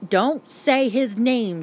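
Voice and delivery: slow, deliberate hushed warning; a mother's urgent, measured warning. A narrator's reading. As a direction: cut him off firmly, slowly. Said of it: "she warned".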